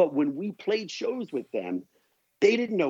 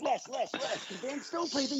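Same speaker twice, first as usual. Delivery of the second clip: high, soft needling voice; pestering promoter; 10 semitones higher, 8 dB quieter than usual. Retold or imitated. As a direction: pester him, light and whiny. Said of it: "they'd be like".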